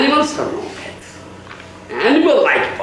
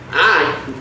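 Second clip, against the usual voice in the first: ordinary delivery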